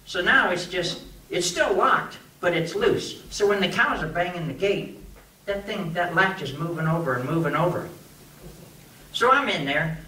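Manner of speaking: usual speaking voice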